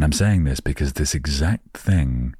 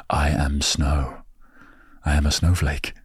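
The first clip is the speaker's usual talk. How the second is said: mock-solemn whisper. Grand self-naming, laughed whisper.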